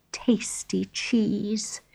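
unvoiced, slow dreamy whisper; whispered longing. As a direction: breathe the words with longing. Mouse dreaming of cheese.

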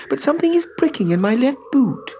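put-on high little girl's voice; a small girl complaining about her boot. A narrator's reading. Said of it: "said Trudy".

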